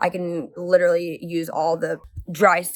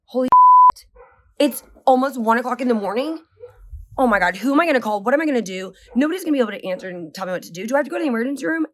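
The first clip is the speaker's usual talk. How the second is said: higher and fast, spiraling into panic; panicked rant; 5 semitones higher, 4 dB louder, faster than usual. Retold or imitated. Wife in the bathtub panicking at one in the morning, high frantic rush. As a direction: spill the fear out faster and faster.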